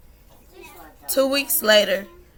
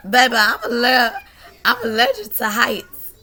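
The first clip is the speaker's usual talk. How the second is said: higher, louder, laughing through the words; amused inner reply; laughing retort; 4 semitones higher, 7 dB louder than usual. Retold or imitated. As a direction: joke about heights, half laughing. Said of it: "I'm like".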